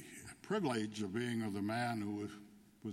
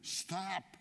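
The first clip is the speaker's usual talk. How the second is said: lightly acted high cry; a mild, high-pitched cry to halt; 8 semitones higher than usual. A narrator's reading. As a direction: call it out high, without real volume. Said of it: "he cried".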